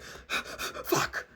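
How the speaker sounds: gasping, unvoiced mock panic; acted panic. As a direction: gasp the curse out under your breath. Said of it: "I'm not gonna be like".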